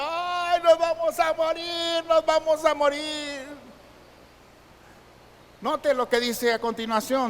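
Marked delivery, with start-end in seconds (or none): 0.0-3.5 high, drawn-out mock wail; lamento a gritos. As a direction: wail it like a panicking, weeping crowd. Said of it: "Están llorando, están gritando". (lamento agudo y prolongado, imitando llanto)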